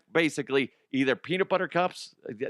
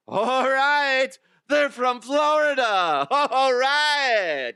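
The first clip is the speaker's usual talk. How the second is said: loud, high booming announcer shout; announcer reveal; 12 semitones higher, 6 dB louder, much slower than usual. Acted. Don Pardo revealing the answer to the audience, booming announcer shout. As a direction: blast the answer like a game-show reveal. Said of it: "Don Pardo, what's the answer".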